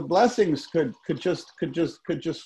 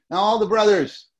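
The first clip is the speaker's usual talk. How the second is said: ordinary talk, nothing marked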